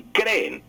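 creen stressed on the first E: CRÉ-en; drawn-out creen, weight on CRÉ; acento grave. Shown as a demonstration. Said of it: "con acento grave". (creen con acento en la primera e)

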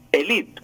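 elite said e-LI-te, stress on the LI. Shown as a demonstration. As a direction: lean on the I of elite. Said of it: "con acento en la I".

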